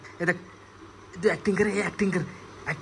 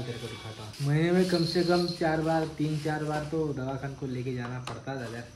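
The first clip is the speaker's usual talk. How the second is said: ordinary talk, nothing marked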